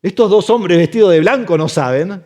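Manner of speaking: ordinary delivery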